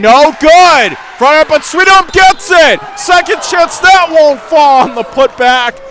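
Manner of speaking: sudden loud burst climbing high; excited call. Real, not acted. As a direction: erupt as the rebound is grabbed. Missed free throw, offensive rebound.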